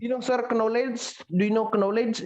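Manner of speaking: "knowledge" as "kuh-no-ledge"; mispronounced knowledge. Retold or imitated. Student asking Sir, knowledge as "kuh-no-ledge". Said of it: "jangan knowledge".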